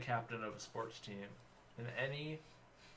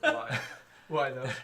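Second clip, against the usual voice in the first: laughing out loud in disbelief; cracking up while asking why; 2 semitones higher, 10 dB louder, slower than usual. Real, not acted. Baffled friend asks why through laughter.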